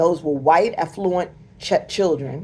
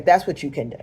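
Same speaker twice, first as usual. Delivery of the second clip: ordinary delivery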